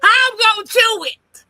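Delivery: high, loud protest; indignant shout. Real, not acted. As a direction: throw the question back loud. Protests she has no teeth.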